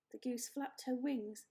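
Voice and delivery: ordinary delivery, nothing marked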